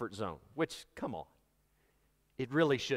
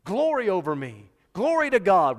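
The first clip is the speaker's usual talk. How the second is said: loud, higher burst of praise; excited exclamation; 4 semitones higher, 10 dB louder than usual. Acted. Preacher showing an expression of excitement, exclaimed loudly. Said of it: "it was an expression of excitement".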